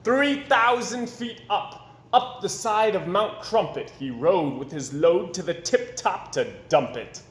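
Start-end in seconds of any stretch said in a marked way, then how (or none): none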